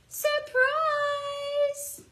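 high, loud playful yell; tree yelling surprise. Acted. Teacher voicing the tree for the class, playful high yell. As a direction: burst out like a whole tree shouting. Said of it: "it looks like the tree yelled".

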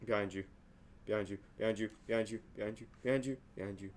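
low, soft, rapid-fire little repeats; quiet, urgent chant of warning; hushed warning. Real, not acted. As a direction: murmur it over and over under your breath.

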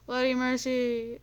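hammed-up pleading cry; mock plea. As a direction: beg dramatically for bloody mercy.

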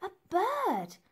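high, loud alarm; alarmed exclaim. A narrator's reading. Narrator finding the attacker, high alarmed cry. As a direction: cry out the discovery.

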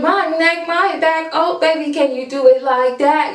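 high cute imitation of her voice; imitating cute voice. Fan copying the rapper's cute voice, high and sweet. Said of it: "how she sang that".

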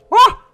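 a sudden very high, very loud scream; real jump-scare shriek; startled shriek. Real, not acted. Player spotting the creepy cannibal, shrieking.

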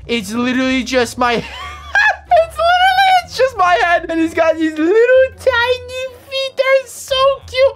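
squeaky, very high through laughter; hysterical laughing. Real, not acted. Seeing the bee is just his head, hysterical. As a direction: lose it, squealing the words. Loses it over tiny bee.

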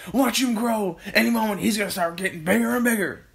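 high, loud, rushing prediction; excited cry. A narrator's reading. Mister Cranky waiting for the bird to grow, excited cry. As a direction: shout it in mounting anticipation. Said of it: "cried Mister Mister Cranky".

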